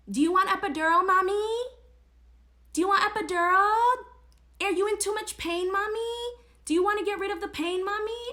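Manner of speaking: high, pushy singsong offer, rising at each end; mock nurse. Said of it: "a nurse would run in and tell me".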